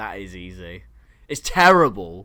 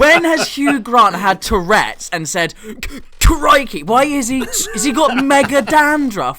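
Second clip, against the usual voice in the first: louder, higher, slowed ranting; excited mockery; 6 semitones higher, 6 dB louder, slower than usual. Real, not acted. Friend ribbing the bad Hugh Grant, loud excited rant. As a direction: rant at him, laughing disbelief. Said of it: "mocking the impression".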